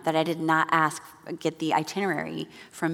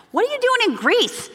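much higher, louder rising question; alarmed question; 5 dB louder than usual. Retold or imitated. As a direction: demand it in disbelief.

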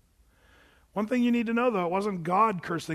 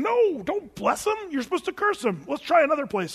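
exasperated protest; 8 semitones higher than usual. Retold or imitated. Balak protesting to Balaam, high and exasperated.

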